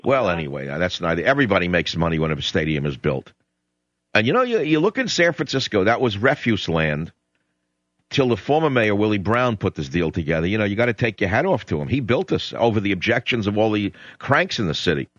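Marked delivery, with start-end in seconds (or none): none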